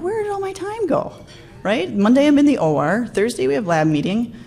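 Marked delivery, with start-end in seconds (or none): 0.0-1.1 pitched way up in sudden alarm; a shocked wail at the calendar; dismayed surprise. Retold or imitated. Looking at a full calendar, shocked and much higher. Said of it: "I'm like".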